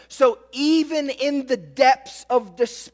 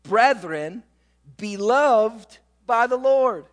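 scripture reading; slower than usual. Shown as a demonstration. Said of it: "Verse thirteen".

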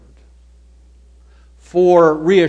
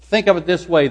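ordinary talk

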